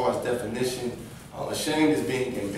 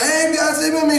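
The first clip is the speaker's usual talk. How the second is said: eager greeting; 12 semitones higher, 6 dB louder than usual. Retold or imitated. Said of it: "you're like".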